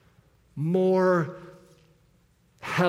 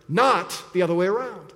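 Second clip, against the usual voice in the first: ordinary talk, nothing marked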